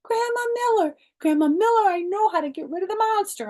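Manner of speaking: very high, loud, fast calling out; hollering. A narrator's reading. Girl runs home hollering to Grandma Miller, very loud. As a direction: holler across the house. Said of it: "I hollered".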